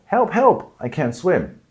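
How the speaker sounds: high panicked call for help, pitch jumping up; cry for help. Pretend. Drowning swimmer cries out for help.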